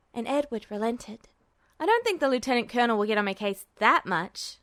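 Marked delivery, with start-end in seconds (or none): 1.8-4.6 lightly self-assured; confident. A narrator's reading. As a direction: say it with easy confidence. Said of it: "Edward said confidently".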